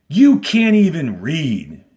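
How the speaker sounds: slow, cutting sneer as the warden; warden's cold jab. Acted. Teacher voicing the warden sneering at Zero. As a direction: lean on each word with contempt. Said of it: "she said to Zero".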